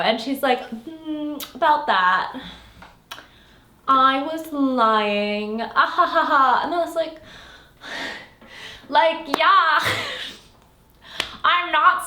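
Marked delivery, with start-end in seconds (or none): 0.7-6.6 slow, drawn-out gloat ending in a mocking laugh; gloating villain. Evil woman taunts Seely after tricking her, gloating with a laugh. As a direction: savour the betrayal, then cackle.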